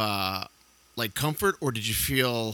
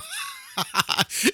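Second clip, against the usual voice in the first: high shrieking laugh. Real, not acted. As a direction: burst out laughing at the answer. Host reacts to grim answer.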